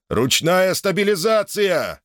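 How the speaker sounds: high, drawn-out cry; shouted command. A narrator's reading. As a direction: call the order out high and long. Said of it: "крикнул Меткав".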